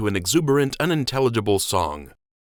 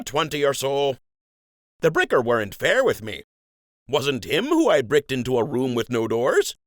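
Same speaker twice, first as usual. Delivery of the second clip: folksy dockhand, pitched up; rustic docker voice; 6 semitones higher than usual. Acted. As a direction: talk like a plain-spoken old docker. Jimmy tells his jobs.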